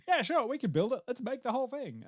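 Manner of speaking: high, carefree yes-man; breezy big-budget boss; eager producer. Retold or imitated.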